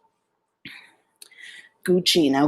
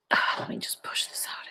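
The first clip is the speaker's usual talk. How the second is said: whisper; 11 dB quieter than usual. Real, not acted. Whispering while fixing the microphone cord.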